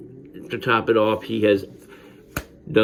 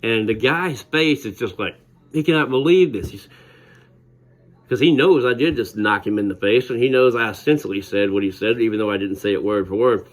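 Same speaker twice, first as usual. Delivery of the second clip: ordinary delivery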